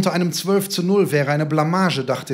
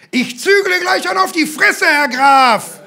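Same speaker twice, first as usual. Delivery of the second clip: high, loud furious retort; enraged high-pitched shout; shouted threat; 12 semitones higher, 6 dB louder than usual. A narrator's reading. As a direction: yell it straight at your rival. Father threatens Lebeck in quarrel.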